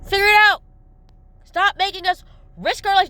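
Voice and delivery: high, loud, fed-up demand; loud exasperated order to the employer; angry outburst. Real, not acted. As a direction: throw it at the boss, exasperated.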